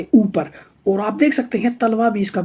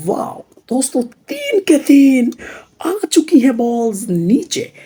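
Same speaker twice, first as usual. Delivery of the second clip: excited reveal; 3 semitones higher, slower than usual. Pretend. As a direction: stretch it out in wonder. Reveal of the trick. (जोश भरा खुलासा)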